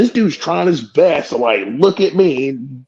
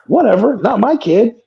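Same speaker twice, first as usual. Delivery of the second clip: higher breezy shrug; dismissive; 8 semitones higher than usual. Retold or imitated. Wrestler shrugging off the baby, breezy dismissive. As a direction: toss it off like it's nothing. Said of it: "And I'm like".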